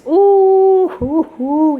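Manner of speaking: long high "ooh" breaking into hoots; whoop, then hoot with delight; elated whooping. Real, not acted. Exclaims over word meaning.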